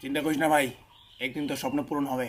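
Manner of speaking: low, soft reassurance under the breath; hushed aside. Acted. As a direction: murmur it secretly, keeping it low. Husband's low private aside.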